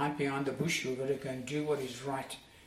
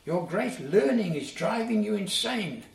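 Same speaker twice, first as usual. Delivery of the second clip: loud and higher, falling scornful cry; shouted accusation; 6 semitones higher, 5 dB louder than usual. Acted. Agrippa shouting at Paul, affronted, loud. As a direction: shout it in shocked outrage. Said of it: "he shouted".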